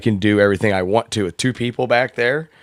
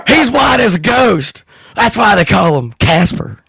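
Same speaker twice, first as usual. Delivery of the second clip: Harry Carey; 7 semitones higher, 4 dB louder than usual. Pretend. Cohost asks for the voice, loud high announcer impression. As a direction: belt it out like an old ballpark announcer. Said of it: "in Harry Carey's voice about the Casper-Matic".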